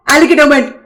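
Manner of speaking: ordinary delivery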